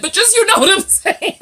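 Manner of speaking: high, loud, dissolving into laughter; cracking up. Real, not acted. Vlogger excuses the botched accent, cracking up laughing. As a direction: talk through a rising fit of laughter.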